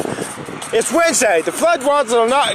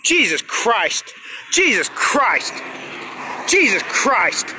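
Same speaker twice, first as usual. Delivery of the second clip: exasperated; 4 dB quieter, much slower than usual. Real, not acted. Worn out, muttering in exasperation.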